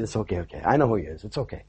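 soft, low soothing reply; quiet reassurance. Retold or imitated. Pastor reassuring the upset wife, softly soothing.

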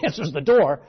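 usual speaking voice

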